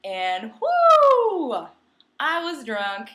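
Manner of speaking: ordinary talk, nothing marked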